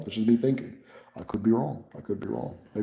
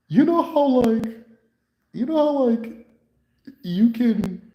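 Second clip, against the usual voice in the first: imitating a patient pitching a silly invention; impression of the patient; 11 semitones higher than usual. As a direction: pitch it way up and drift through the idea. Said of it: "This is exactly how he sounds".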